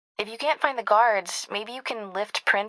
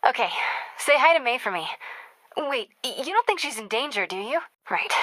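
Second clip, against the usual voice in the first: ordinary delivery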